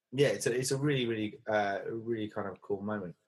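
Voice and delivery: ordinary delivery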